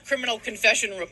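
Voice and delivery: ordinary delivery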